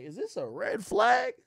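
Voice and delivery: high, slow, doubting question; wary aside. Retold or imitated. To himself about his girlfriend, slow and suspicious. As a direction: wonder it aloud, suspicious. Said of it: "low-key like".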